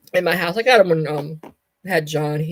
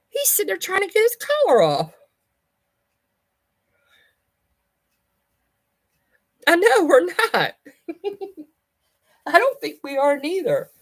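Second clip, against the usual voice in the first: lingering much higher voice; high pet voice; 12 semitones higher, 3 dB louder than usual. Real, not acted. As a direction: still pitched up from calling the cat. Still in high voice after cat.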